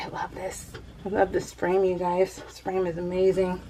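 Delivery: soft, breathy-quiet awe; almost whisper it, in love with it; hushed admiration. Real, not acted. Showing viewers her new frame, hushed and soft.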